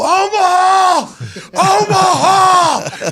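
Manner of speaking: high, drawn-out shout; full-on quarterback call. Retold or imitated. Backup quarterback hamming up an audible for coach, drawn-out shout. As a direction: holler it long and high across the line. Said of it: "I do a full".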